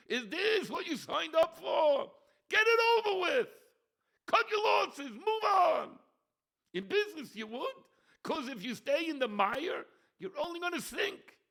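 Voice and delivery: high, loud preacher's shout; fiery preaching. Acted. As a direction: thunder it from the pulpit. Preaching at full force.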